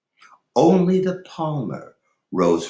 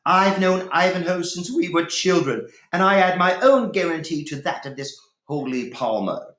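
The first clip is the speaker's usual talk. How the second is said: indignant Rowena; 8 semitones higher than usual. A narrator's reading. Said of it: "jumping up angrily".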